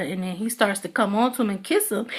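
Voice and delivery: usual speaking voice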